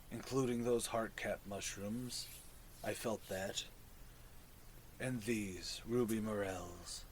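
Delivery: low, soft fungal creature voice; mushroom voice. Acted. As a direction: speak low and hushed like a mushroom.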